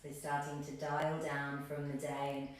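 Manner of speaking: calm, low-key breathing cues, letting phrases settle; guided meditation. Acted. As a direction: guide them gently into stillness, unhurried.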